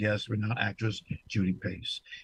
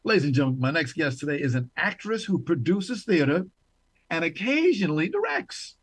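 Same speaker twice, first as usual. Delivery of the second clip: big, swooping talk-show welcome; show host introduction; 3 semitones higher than usual. Acted. Host presenting an actress to the audience, showy announcer introduction.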